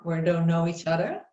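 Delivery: ordinary delivery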